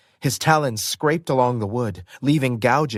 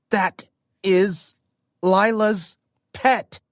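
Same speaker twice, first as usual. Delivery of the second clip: gritted teeth; 8 semitones higher, slower than usual. Acted. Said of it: "I said through gritted teeth".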